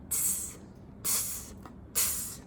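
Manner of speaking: whispered tss tss tss; monkey tsking. Acted. Monkeys answering the peddler, whispered tsking. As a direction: tut at him like a cheeky monkey. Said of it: "and said".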